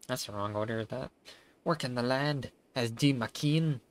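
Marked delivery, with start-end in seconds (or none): 1.6-3.8 louder, slow mock Scottish farmer; Scottish accent. Voicing the village elder Edwin in a hammed-up Scottish accent. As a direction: lay on a Scottish burr, slowly. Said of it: "the fuck's with the way this dude's talking".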